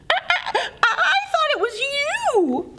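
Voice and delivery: high, stammering mouse with a nervous laugh. Acted. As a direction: stutter it out, scared and small. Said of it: "squeaked Mouse nervously".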